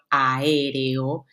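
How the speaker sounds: AÉREO with each vowel drawn out separately; vowels separated. Shown as a demonstration. Teacher giving an example of hiatus, vowels separated in AÉREO. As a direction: stretch AÉREO slowly, vowel by vowel.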